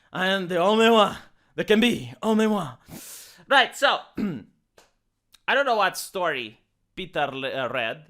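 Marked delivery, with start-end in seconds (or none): 0.1-2.7 a hint of a grim film hero; Highlander quote. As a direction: intone it like an epic movie line. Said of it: "It's like in Highlander".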